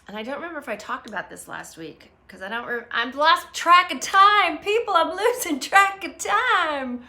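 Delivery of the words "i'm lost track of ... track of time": high, loud, slow comic lament; mock wail. Streamer confessing to viewers, mock wail. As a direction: draw it out in a playful high wail. Playfully wailing about losing track.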